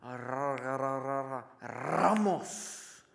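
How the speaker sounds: imitating a name being searched out, stuttered then landed; mock prophetic name-calling. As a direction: stammer syllables into a drawn-out name. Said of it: "he's telling a story, and he goes".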